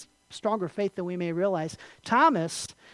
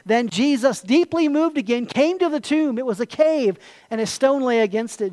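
loud, raised, declamatory reading; dramatic scripture reading; 5 semitones higher, 6 dB louder than usual. Acted. Preacher reads the climax of Lazarus story to congregation, dramatic. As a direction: read the verse out to the whole congregation.

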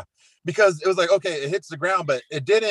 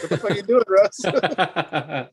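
higher mock-exasperated cry breaking into laughter; laughing mock outrage; 5 semitones higher, slower than usual. Fantasy owner yelling at the quarterback on TV, laughing mock outrage. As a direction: protest in mock disbelief, laughing. Reacting to his fantasy opponent's targets.

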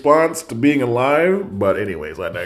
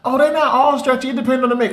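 much higher, casual mock voice; imitating sister; 3 dB louder, faster than usual. Sister explaining jeans brands, in high mock voice. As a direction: play the sister breezily, up high. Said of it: "She was like".

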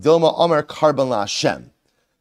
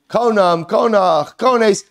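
proclaiming the words; 7 semitones higher, 5 dB louder, much slower than usual. Acted. Teacher proclaims the invented words.

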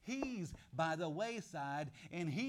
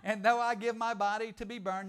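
laughing reading; 3 semitones higher, 7 dB louder than usual. Real, not acted. Laughing while reading verse.

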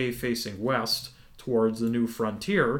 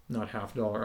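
ordinary delivery

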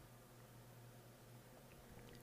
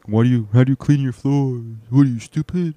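brother impression; 2 semitones higher, 5 dB louder than usual. Brother belittling his floor cleaning, mocking put-on voice. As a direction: put on an exaggerated scolding brother voice. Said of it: "This is how my brother talks".

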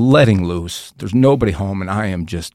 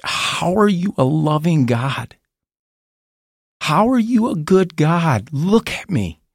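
higher, drawn-out cry of protest to God; anguished plea; 8 semitones higher, slower than usual. Retold or imitated. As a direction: re-enact a desperate, drawn-out challenge to God. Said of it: "I'm just bawling, and I'm like".

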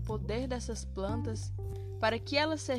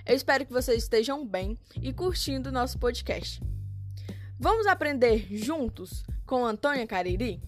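put-on kids show host; 7 dB louder than usual. Podcast host intro over music.

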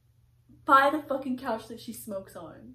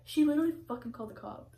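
usual speaking voice

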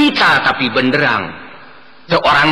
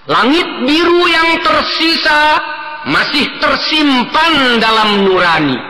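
high, grand poet declaiming; hammy stage actor; 11 semitones higher than usual. Acted. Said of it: "Seorang penyair sibuk berdeklamasi".

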